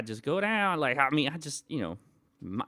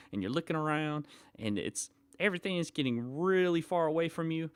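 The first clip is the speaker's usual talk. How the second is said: normal talking voice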